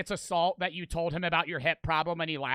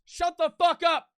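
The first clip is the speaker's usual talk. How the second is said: high, louder outburst; retold screaming; 11 semitones higher, 6 dB louder than usual. Drunk friend to the old man, screaming back. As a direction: snap it back in a raised, high voice.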